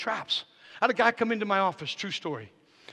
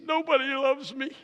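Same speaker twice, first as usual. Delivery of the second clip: higher-pitched whiny plea; mock whining; 8 semitones higher than usual. Friend coming into the pastor's office for help, imitated whining. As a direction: imitate a man feeling sorry for himself. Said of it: "he's kinda whining".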